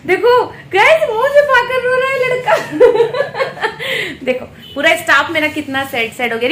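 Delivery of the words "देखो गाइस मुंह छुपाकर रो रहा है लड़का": high, loud and bursting into laughter; laughing teasingly. Real, not acted. Vlogger laughing at colleague hiding his tears, loud and high. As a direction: tease him through real laughter.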